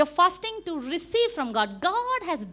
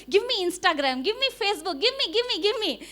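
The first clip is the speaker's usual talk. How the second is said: greedy fingers; 7 semitones higher than usual. Retold or imitated. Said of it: "your fingers will say".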